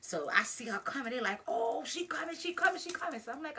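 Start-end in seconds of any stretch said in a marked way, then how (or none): 1.5-3.2 high, urgent repeated warning; acted-out buzzing crowd, pitched way up; imitated excited onlookers. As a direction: mimic kids spotting someone coming. Onlookers announcing opponent's arrival.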